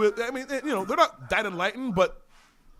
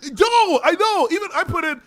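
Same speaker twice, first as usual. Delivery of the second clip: higher and louder, eagerly agreeing; excited agreement; 10 semitones higher, 7 dB louder than usual. Real, not acted. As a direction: jump in, eager and loud.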